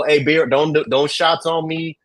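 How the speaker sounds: ordinary talk, nothing marked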